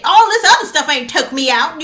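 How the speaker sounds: normal talking voice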